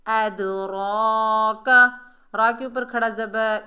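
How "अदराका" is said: अदराका recited slowly with a full, long-held "raa"; elongated recitation. Shown as a demonstration. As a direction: draw out the "रा" in अदराका. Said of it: "एक अलिफ की मिकदार खींच कर पढ़ेंगे".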